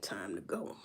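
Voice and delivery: low whispered "time to go"; whispered punchline. Real, not acted. Telling viewers when to leave someone, whispered. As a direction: whisper the verdict. Punchline about leaving a partner.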